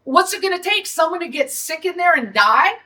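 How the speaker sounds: ordinary talk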